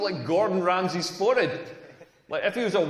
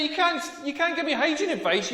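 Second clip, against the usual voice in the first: normal talking voice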